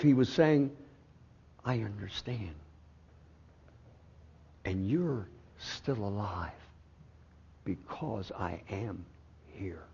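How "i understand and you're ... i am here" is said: gentle reassurance. Retold or imitated. God comforting the frightened sick man, soft and tender. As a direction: speak it like a quiet embrace. Voicing God's gentle answer.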